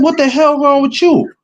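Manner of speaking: high, incredulous snap at Tiff; retold, half-mock outrage; exasperated retort. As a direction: push the disbelief up high. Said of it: "I said".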